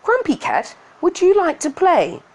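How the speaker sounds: high, bright cheery bug voice; butterfly voice. Acted. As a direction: squeak it out like a happy butterfly. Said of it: "the butterfly asked".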